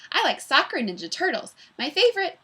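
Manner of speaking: put-on small girl bursts out her favorites, high and bouncy; bouncy energetic girl. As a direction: bounce like a Super Ball. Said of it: "full of energy like a Super Ball".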